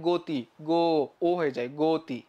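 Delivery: গতি with a closed o, isolating গো; correct pronunciation. Shown as a demonstration. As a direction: sound out গো then গতি with rounded o. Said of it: "আমরা কি বলি?".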